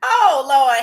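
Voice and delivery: loud, crying exclamation; loud weepy lament; loud oh lord. Real, not acted. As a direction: cry out to the Lord. Exclaims at memory of being upset.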